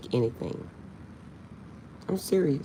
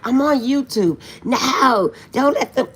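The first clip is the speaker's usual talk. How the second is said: mock panic; 9 semitones higher, 8 dB louder, slower than usual. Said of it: "and I'm like".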